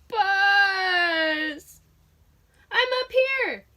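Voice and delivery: loud high yell for help; calling out, high and loud. A narrator's reading. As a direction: shout up to him from far away. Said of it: "yelled Buzz".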